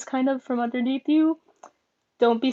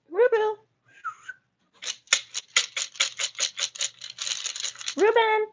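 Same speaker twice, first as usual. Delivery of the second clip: high sing-song call; pet call; 12 semitones higher than usual. Real, not acted. Calling the pet Ruben to eat. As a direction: coo the name up high.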